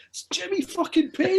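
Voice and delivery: high, loud excited exclamation; starstruck outburst. Real, not acted. Telling his friend about meeting [name], excited outburst. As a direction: blurt it out amazed.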